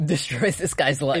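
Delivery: normal talking voice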